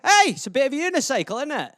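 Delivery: hammed-up Scottish lilt; Scottish accent. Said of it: "some Scottish euphemisms for you".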